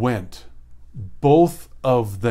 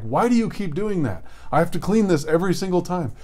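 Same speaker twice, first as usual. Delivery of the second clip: mock exasperated scold, higher and fast; scolding parent; 6 semitones higher, faster than usual. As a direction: nag a child about the handprint again. Parent scolding child over handprint.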